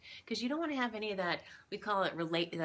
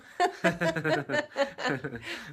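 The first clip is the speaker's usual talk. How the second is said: loud burst of laughter; 7 semitones higher, 7 dB louder than usual. Real, not acted. Laughing at breast remark.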